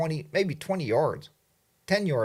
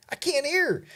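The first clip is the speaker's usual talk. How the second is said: "I can't hear her" jumping much higher, frustrated; high exasperated repeat. Retold or imitated. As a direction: throw it up high like you give up. Wind blowing, can't hear wife.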